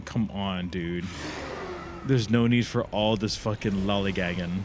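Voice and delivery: ordinary delivery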